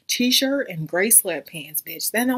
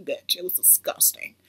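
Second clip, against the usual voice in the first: ordinary delivery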